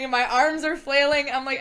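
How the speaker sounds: usual speaking voice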